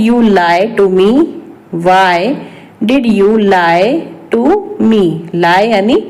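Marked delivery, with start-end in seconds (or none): 1.9-5.0 broken up with clear pauses; word-by-word repeat. Shown as a demonstration. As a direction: dictate it to learners piece by piece. (एक-एक शब्द रुक कर)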